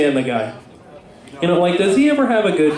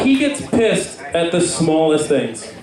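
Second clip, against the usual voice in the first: ordinary talk, nothing marked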